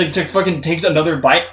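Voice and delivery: ordinary delivery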